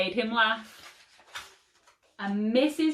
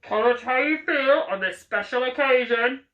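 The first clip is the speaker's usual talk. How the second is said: ground control; 3 semitones higher, 6 dB louder than usual. A narrator's reading. Ground control over radio.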